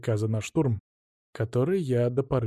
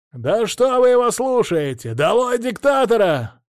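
agitator shouting; 10 semitones higher, 6 dB louder than usual. Acted. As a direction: whip up the crowd. (крик провокатора в толпе)

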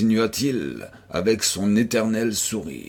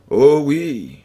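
chilling tone; 4 semitones higher, 6 dB louder than usual. A narrator's reading. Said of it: "fit-il avec un accent qui me glaça".

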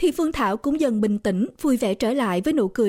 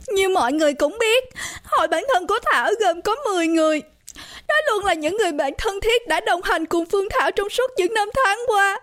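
high, tearful, sobbing speech; bursting into tears; 9 semitones higher than usual. A narrator's reading. Birthday girl mourning her friends onstage, crying. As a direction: wail it out, breaking down on the last words. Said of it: "Thảo chật bật khóc".

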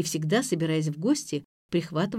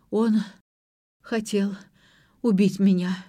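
halting, with a pause after each word; faltering babble. A narrator's reading. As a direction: stammer it out as if in shock. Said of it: "лепетала Ира".

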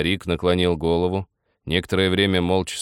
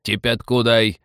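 commanding, higher and a little louder; firm order; 4 semitones higher, 5 dB louder, slower than usual. A narrator's reading. Old man orders Nikita about, firm command. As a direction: demand it firmly. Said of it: "твёрдо приказал старик".

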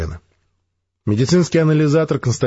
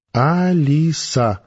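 higher, slow, tasting each sound of Алиса; the girl tries out her new name, slow and higher; savouring the name; 6 semitones higher, much slower than usual. Acted. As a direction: say Алиса slowly and higher, listening to it. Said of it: "вслушиваясь в звуки".

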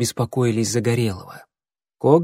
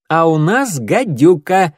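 proud announcement; 5 semitones higher, 6 dB louder, slower than usual. A narrator's reading. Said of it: "заявил Клубок".

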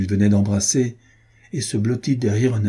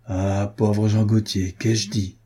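plain reading voice

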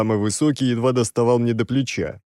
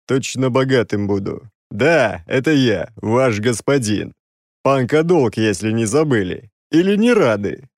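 lightly voiced Odessa uncle; a hint of Odessa sing-song; Odessa accent; 3 dB louder than usual. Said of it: "Голосом дяди Изи из Одессы".

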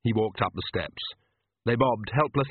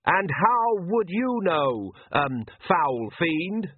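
higher, very slow, halting defiance; faltering priest's challenge; 7 semitones higher, much slower than usual. Acted. Oates defying the Count, higher and halting. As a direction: summon courage mid-sentence.

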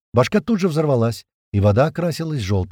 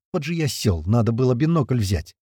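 ordinary delivery

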